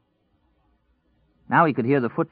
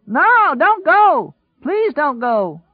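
high, loud, drawn-out screamed plea; desperate pleading scream; 9 dB louder, much slower than usual. A narrator's reading. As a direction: scream after someone leaving. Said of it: "he screamed".

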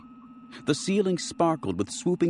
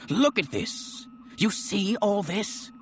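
much higher, rising toward panic, slow on the question; a frantic colonel pitching higher and higher; going shrill; 9 semitones higher, slower than usual. Acted. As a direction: push the voice up as he loses control. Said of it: "His voice was going shrill".

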